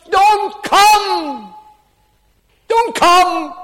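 loud high cry of warning. Acted. Lost soul warning loved ones, high shout. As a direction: shout it as a desperate plea from hell. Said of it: "crying out tonight".

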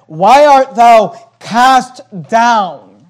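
slow, loud, drawn-out reading of the verse; preacher intoning a psalm; declaimed scripture. Acted. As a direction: let each word ring out from the pulpit.

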